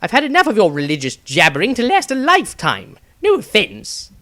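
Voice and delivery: fed-up shout at the zealot; shrill impatient outburst, tacked-on apology; agitated. A narrator's reading. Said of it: "Maestro was agitated".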